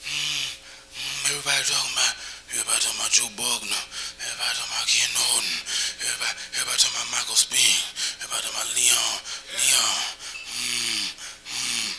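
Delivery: low, fast mumbling boxer ramble; low rapid Ali mumble trailing into whispers; Muhammad Ali. Retold or imitated. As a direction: rattle off the names low and quick, fading to a whisper. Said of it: "Ali is like".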